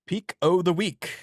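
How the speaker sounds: a bad stab at an Irish lilt on Peak o' the week; failed Irish accent. Shown as a demonstration. Cohost attempting an Irish accent on the pick of the week, badly faked Irish accent. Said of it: "I can't even do a fucking Irish accent".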